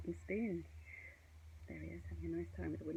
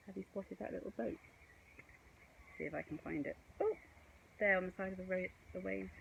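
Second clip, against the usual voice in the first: ordinary delivery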